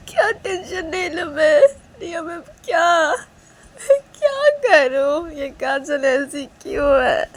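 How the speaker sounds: high, slow, tearful pleading; a worried friend near tears, high and slow; distraught crying girl. Acted. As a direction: sob out the worry, voice cracking high. Reshma frets, high crying voice.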